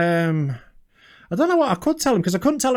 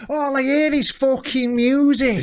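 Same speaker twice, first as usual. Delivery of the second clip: Northern, raised moody rocker; Northern accent; 6 semitones higher than usual. Acted. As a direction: grumble like a jaded rock star. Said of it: "[name]".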